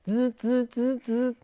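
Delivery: buzzing like a vibrating phone; imitated phone buzz. Phone buzzing during recording.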